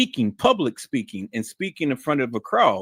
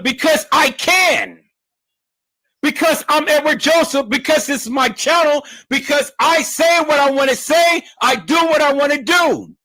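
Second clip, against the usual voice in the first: high, loud hollering; screaming and hollering; 11 semitones higher, 4 dB louder than usual. Shown as a demonstration. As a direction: ramp up the energy and holler. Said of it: "why are you screaming and hollering".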